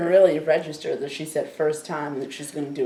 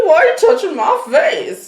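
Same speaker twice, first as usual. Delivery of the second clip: giddy high-pitched stoner awe; stoned and blissed out; 13 semitones higher, 8 dB louder than usual. Acted. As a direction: marvel at everything like you're high. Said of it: "He said".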